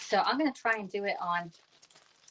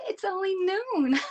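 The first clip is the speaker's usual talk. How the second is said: higher, slow, prim mock protest; mock scandalized; 12 semitones higher, 4 dB louder, slower than usual. Host jokingly refuses whiskey at midday, high and slow. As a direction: clutch the pearls in jest. Joking about drinking wild turkey.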